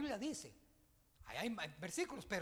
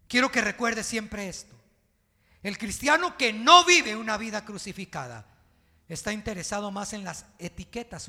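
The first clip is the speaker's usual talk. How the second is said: loud, soaring preacher's proclamation; impassioned pulpit cry; fiery preaching; 3 semitones higher, 17 dB louder than usual. Acted. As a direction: shout the conviction to the church. Pastor preaching at full force.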